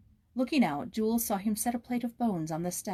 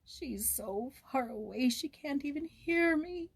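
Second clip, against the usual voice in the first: high, slow tearful moan; 7 semitones higher, slower than usual. A narrator's reading. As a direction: moan it in despair. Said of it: "he moaned".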